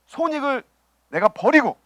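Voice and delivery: ordinary delivery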